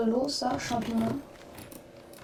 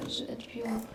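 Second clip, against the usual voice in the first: whispered; 12 dB quieter than usual. Real, not acted. Rereading name under breath.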